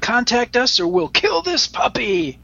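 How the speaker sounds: high put-on threatening voice; hammed-up joke menace, pitched high; mock threat. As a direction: threaten the listeners in jest. Joke threat to listeners, then kidding.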